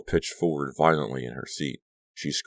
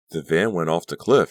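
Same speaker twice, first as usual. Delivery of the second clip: breathless gasp; 3 semitones higher, 7 dB louder than usual. A narrator's reading. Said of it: "she gasped".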